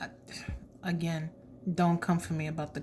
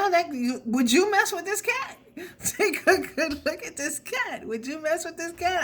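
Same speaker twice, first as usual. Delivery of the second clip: high amused squeal through laughter; laughing disbelief; 10 semitones higher than usual. Real, not acted. Reader laughing at the fierce cat on the card. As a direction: laugh as you dare them to mess with it.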